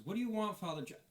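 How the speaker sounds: lightly voiced annoyed mechanic; gruff elf woman. Betty voiced by GM.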